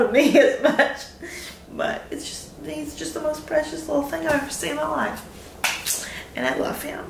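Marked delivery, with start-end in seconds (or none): 1.7-7.0 holding back tears. Real, not acted. New mother near tears about her baby, softly choked up. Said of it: "I feel like I'm gonna cry".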